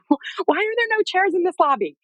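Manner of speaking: high-pitched exasperated plea, hammed up; retold scream. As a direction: act out crying out mid-contraction without full volume. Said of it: "scream out to my husband".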